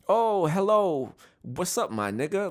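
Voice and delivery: high, cheery fake-friendly hello; mock sweet greeting. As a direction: sing it out like a sugary greeting. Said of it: "people don't talk like that".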